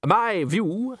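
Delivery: high, emphatic opening; director of music. A narrator's reading. Director of music giving his view, high emphatic voice. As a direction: announce the opinion high and firmly.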